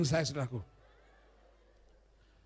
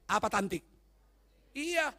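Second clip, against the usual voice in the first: ordinary delivery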